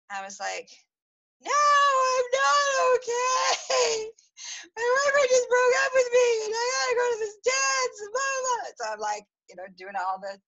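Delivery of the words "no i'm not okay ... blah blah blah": hammed-up teenage sobbing, much higher and louder; wailing crying shout. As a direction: wail it like a heartbroken thirteen-year-old. Retelling herself sobbing after breakup.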